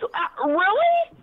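ordinary talk, nothing marked

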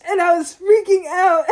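loud, high burst through laughter; laughing hard. Real, not acted. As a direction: crack up while telling it, voice shooting up. Laughing as she relives panic.

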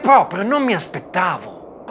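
plain reading voice

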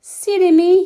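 high, loud giggly self-scold; giggling at his own mistake. A narrator's reading. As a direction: laugh at yourself. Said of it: "he giggled to himself".

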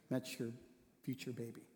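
low, soft, halting delivery; hushed revelation. Retold or imitated. Pastor tells grieving friend about future baby, hushed. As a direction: break the news gently, pausing between phrases. Gently breaking momentous news to friend.

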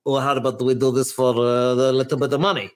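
a hint of an Italian hustler; faint Italian lilt, barely there; mock Italian accent. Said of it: "he sounds a little bit Italian now".

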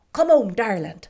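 put-on loud, urgent Irish maid calling the boy; excited nurse. A narrator's reading. Maid fetches the boy from the shop, loud and flustered. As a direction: hurry him home, flustered. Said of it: "as if she were excited".

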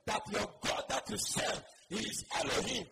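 loud, fervent proclamation; full-force preaching. Acted. Preacher defying the enemy, loud fervent proclamation. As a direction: preach it out over the congregation.

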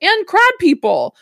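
bright-energy show intro, projected and lifted; podcast opening. Real, not acted. Host welcomes listeners to the podcast, announcer-style opening. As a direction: kick off the episode like a host. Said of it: "I'm gonna do my opening".

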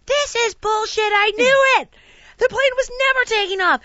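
high, indignant re-enacted yell; hammed-up angry outburst. Traveller to the crew after technical difficulties, angry outburst. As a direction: burst out in mock outrage.

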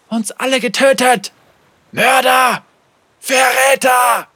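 high, loud shouted accusations; accusing ghostly voices. Acted. Guilty voices thundering in the captain's head, accusing shouts. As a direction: hurl each charge like a broadside. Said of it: "wie eine Breitseite donnerten".

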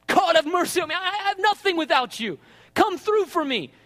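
high, urgent appeal; crying out to God. Acted. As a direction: call out to God with force. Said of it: "he cried out to God".

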